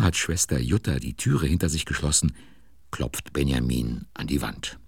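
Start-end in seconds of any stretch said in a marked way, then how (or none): none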